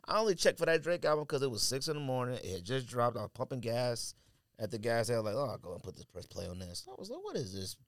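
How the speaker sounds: ordinary talk, nothing marked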